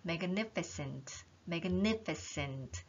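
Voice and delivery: magnificent slow with the stress on ni; stressed reading. Shown as a demonstration. Teacher modelling word stress on magnificent. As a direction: lean on the ni in magnificent. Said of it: "제가 다시 읽어볼게요".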